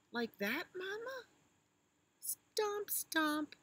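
high, eager little elephant; high child voice. Acted. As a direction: chirp it like a tiny calf. Little elephant tries stomping.

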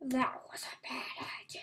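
breathy whispered aside; hushed whisper after the scare. Real, not acted. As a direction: whisper it to yourself, shaken.

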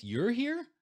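high, loud indignant surprise; shocked and annoyed. Retold or imitated. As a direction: she spots him where he shouldn't be. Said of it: "she realizes".

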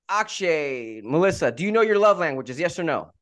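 ordinary talk, nothing marked

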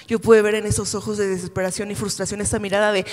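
normal talking voice

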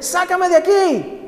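very high, slow cry from inside the oven; desperate scream. Acted. The cup trapped in the hot oven cries out, very high. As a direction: plead to be let out, pitched high. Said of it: "Y volví a decirle".

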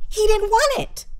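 high squeal of disbelief; squeaky disbelief. Real, not acted. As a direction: jump way up in pitch, incredulous and loud. Shocked punchline about Ronald McDonald.

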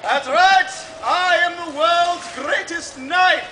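booming showman announcement; carnival barker. Acted. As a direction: sell it to the crowd. Fair barker announcing acts.

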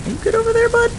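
very high, loud call across the distance. Real, not acted. Gamer calling to a creature far off, high loud call.

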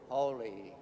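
normal talking voice

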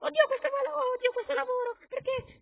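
very high, squeaky worker's lament; strange character voice. Pretend. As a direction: moan about the job in a tiny voice. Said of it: "non so perché ha quella voce strana".

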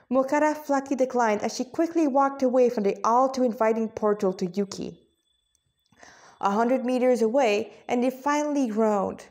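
ordinary delivery

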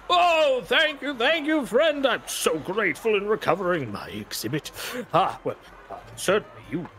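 high, fawning showman's voice; a high, fawning Doctor Malthus; grateful showman. Acted. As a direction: gush thanks like an oily showman. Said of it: "Doctor Malthus comes over".

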